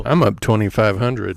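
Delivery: loud game show score call; announcer voice. Pretend. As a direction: boast like a quiz host. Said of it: "I'll get my announcer voice".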